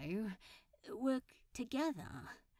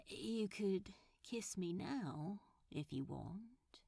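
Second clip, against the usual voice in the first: whisper; 3 semitones lower, 7 dB quieter than usual. Acted. Intimate invitation.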